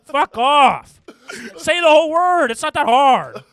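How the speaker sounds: high, shouting Jersey-Italian; loud Italian-American accent. Pretend. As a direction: yell it like a deli guy.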